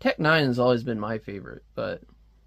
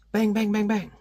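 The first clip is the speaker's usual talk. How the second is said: higher bang bang bang; gunshot sounds; 8 semitones higher than usual. Retold or imitated. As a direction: pop off the shots playfully. Retelling a comic gunfight.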